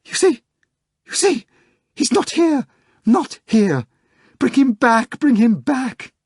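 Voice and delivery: very high, pleading cries; wailing secretary. A narrator's reading. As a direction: beg desperately to bring him back.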